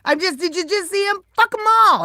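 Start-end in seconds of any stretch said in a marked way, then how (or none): 1.3-2.0 loud and high, fist-pumping; proud rallying cry; defiant shout. Real, not acted. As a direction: shout it like a slogan. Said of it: "That's what I say".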